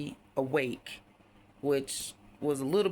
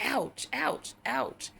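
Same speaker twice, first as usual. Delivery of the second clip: high yelped ouch, ouch, ouch; high mock pain yelps; 9 semitones higher, much slower than usual. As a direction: squeak out mock little ouches. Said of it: "to where I'm like".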